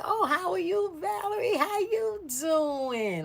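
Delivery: very high, drawn-out singsong hello; friendly greeting. Retold or imitated. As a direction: imitate a gushing greeting, stretching the last word. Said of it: "Like that".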